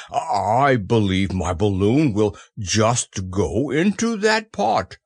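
slow donkey voice lifting at the end; understated, drawn-out excitement; excited Eeyore. A narrator's reading. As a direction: let gloom give way to dawning excitement. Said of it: "he became quite excited".

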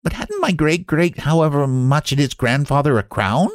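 put-on high-pitched, questioning little boy; high child's voice, rising at the end; young king asking. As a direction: ask it as a puzzled child king.

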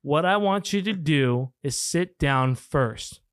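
slow manual reading. Retold or imitated. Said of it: "So he goes".

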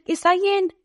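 high-pitched flustered man protesting innocence; panicked examiner. Retold or imitated. Examiner retold, high flustered "Está bien, pero yo no le hice nada". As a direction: squeak it out like a nervous man who did nothing. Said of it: "Y me decía".

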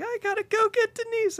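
high, frantic insistence; mock urgency. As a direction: say it as if already running off. Stuntman insists on leaving.

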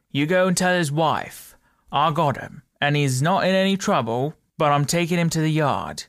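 commanding bobby. A narrator's reading. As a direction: send the man off with clear instructions. Bobby gives orders.

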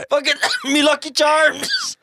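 high laughing mock Irish, slowly; mock Irish accent. Teasing friend about his Irish voice, laughing mock Irish. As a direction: ham up a cartoon leprechaun. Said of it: "She's Irish".

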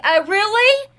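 high, rising sceptical question; retold challenge taken up.

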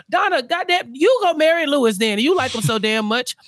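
high, loud fed-up outburst; exasperated rant. Real, not acted. Fan venting at Donna, high and exasperated.

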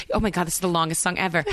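normal talking voice

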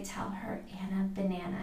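hushed retold whisper, still voiced. Friend retelling her bedside message to Laura, softly imitated whisper. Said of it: "And she whispered it".

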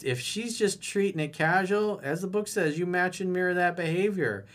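normal talking voice

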